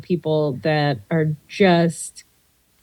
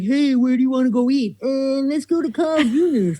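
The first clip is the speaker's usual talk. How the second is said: a higher put-on jock voice for the mound chat; ballplayer voices; 5 semitones higher than usual. Radio host voicing ballplayers at the mound, put-on higher voice. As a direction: voice the players' secret mound talk, higher. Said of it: "that was your job to be like".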